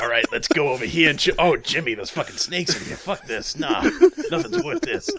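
lightly voiced twitchy junkie; a hint of a sniffing addict. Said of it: "I love your junkie voice".

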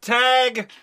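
normal talking voice

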